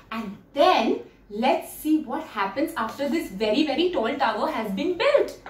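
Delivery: usual speaking voice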